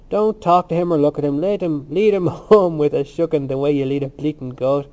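loud, rough dismissal; brutal. A narrator's reading. Cranley tells Dixon to ignore Temple, brutally. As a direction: order him off with some brutality. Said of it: "Cranley said brutally".

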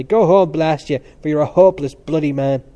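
loud angry shout. A narrator's reading. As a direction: bellow him away. Cranley shouting at Temple.

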